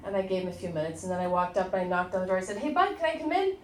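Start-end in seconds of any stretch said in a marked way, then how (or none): 2.6-3.6 gentle knock call. Retold or imitated. Mom knocking on son's door.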